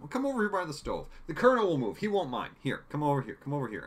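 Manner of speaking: high, loud, hurried coaxing; fussy insistence. Retold or imitated. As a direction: fuss over him and usher him along. Acting out Borelli directing Hawkeye around.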